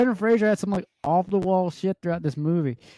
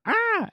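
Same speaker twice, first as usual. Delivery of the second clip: mock mummy scream. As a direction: shriek like a startled mummy, played for laughs. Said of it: "the mommy's like".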